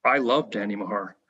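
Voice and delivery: low, earnest retold declaration; all seriousness. Coach confiding in a fan at lunch, in all seriousness. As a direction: drop low and mean every word. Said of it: "All seriousness".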